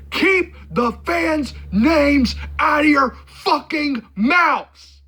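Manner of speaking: high, slow yell, word by word; hammed-up outraged shout, stretching each word; mock furious yell. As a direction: yell it like a famous outburst, drawn out. Yelled quote of a known reference.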